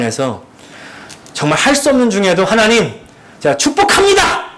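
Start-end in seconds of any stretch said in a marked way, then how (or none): 2.4-4.5 forced blessing squeezed out much higher through gritted teeth. Retold or imitated. Pastor forcing himself to bless his enemy, through gritted teeth. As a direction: bless them while every bit of you resists. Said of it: "막 이렇게 이를 악물고".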